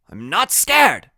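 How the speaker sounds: high, slow, loud denial; high-pitched, drawn-out insistence; Freak's protest. A narrator's reading.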